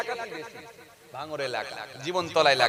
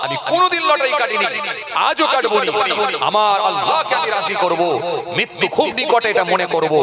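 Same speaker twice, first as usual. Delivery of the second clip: preaching at full force; 4 dB louder than usual. Acted. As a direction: declare it with fire. Fervent resolve in sermon.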